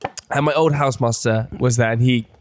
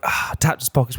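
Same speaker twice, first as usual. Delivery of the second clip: ordinary delivery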